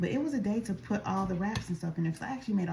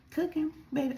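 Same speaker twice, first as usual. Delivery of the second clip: ordinary talk, nothing marked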